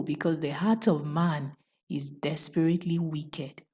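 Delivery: plain reading voice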